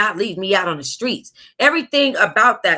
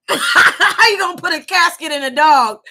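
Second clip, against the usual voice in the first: laughing through the words; can barely get it out for laughing; 8 semitones higher, 4 dB louder than usual. Real, not acted. Laughing while reading aloud.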